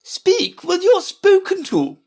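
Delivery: very high, loud, slow imperious queen; sharp interruption. A narrator's reading. Red Queen interrupting Alice, sharp and imperious. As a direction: cut in loudly in a high voice. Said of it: "the Queen sharply interrupted her".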